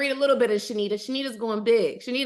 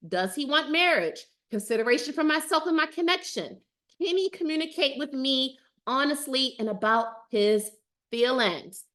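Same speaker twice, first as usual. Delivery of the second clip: ordinary delivery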